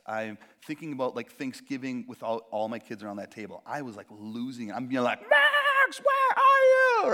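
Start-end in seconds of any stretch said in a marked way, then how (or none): 5.2-7.1 calling out loud and high, stretched slow; frantic shout. Retold or imitated. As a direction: yell for the missing son across the park. Lost son, searching and calling.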